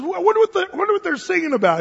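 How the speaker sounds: a hint of a curious dad; dad wondering. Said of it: "He said".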